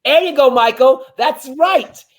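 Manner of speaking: high, loud cheer. Real, not acted. Shouting along his morning routine, excited and loud. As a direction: cheer yourself on loudly.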